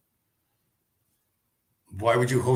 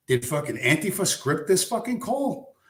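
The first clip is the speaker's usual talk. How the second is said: incredulous; 8 semitones higher than usual. Real, not acted. Incredulous outburst.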